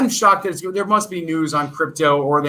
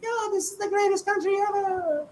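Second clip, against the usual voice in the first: much higher and softer, a gushing put-on citizen voice; mock rich Turkish citizen; 8 dB quieter than usual. Streamer mocking a crypto-rich Turk, high airy gush. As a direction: play a crypto-rich local bragging dreamily about his country. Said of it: "they'd all be driving Lamborghinis like".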